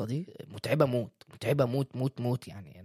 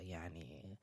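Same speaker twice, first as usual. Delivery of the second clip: breathy whisper for suspense; whispered aside; 9 semitones lower, 22 dB quieter, much slower than usual. Real, not acted. Whispering to the viewers before the reveal. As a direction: drop to a whisper, building suspense. Dramatic pause before a punchline.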